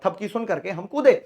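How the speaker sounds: normal talking voice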